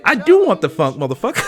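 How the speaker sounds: high, loud shout back at the chant; hyped reply. Retold or imitated. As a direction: yell it back like a fan. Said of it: "And I'd be like".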